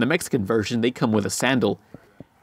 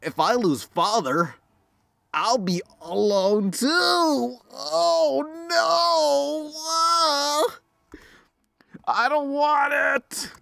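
put-on high, frightened little girl, breaking into a cry; panicked child afraid of losing her father, near tears; scared girl voice; much slower than usual. Voicing scared girl in game.

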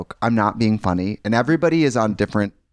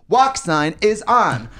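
slow, higher robotic echo; automated crosswalk voice; 7 semitones higher, much slower than usual. Retold or imitated. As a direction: drag it out like the crosswalk box. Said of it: "I'm like".

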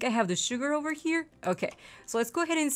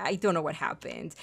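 ordinary delivery

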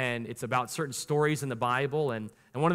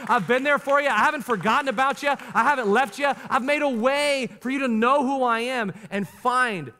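God's reassuring words; 7 semitones higher, 5 dB louder than usual. Retold or imitated. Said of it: "As your heavenly father".

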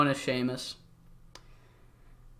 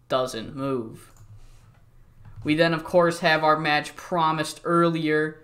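ordinary delivery

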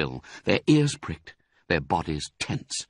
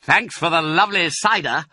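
teasing; 9 semitones higher, 5 dB louder than usual. A narrator's reading. Teasing farewell to Rat.

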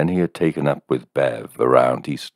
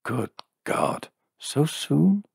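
startled thought; 12 semitones higher, slower than usual. A narrator's reading. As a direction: catch yourself in surprise. Shocked inner thought.

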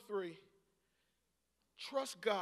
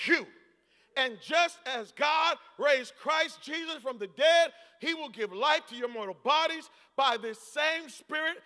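preaching at full force; 5 semitones higher, 11 dB louder than usual. Real, not acted. Pastor preaching Romans to the church, loud. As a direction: drive the scripture home.

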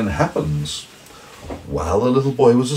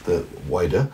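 plain reading voice

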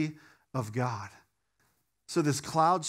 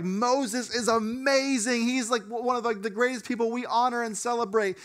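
awed admiration; 6 semitones higher than usual. Retold or imitated. Disciples' awe voiced.

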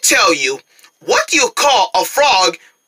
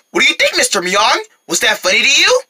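ordinary delivery

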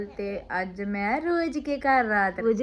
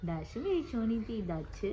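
tender; 10 dB quieter than usual. Real, not acted. Tender words to baby.

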